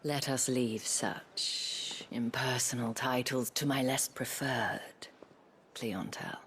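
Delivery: breathy, teasing invitation; seductive whisper. Acted. Isabel teasing Clive, breathy and seductive. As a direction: draw him in with a hush. Courtesan flirting.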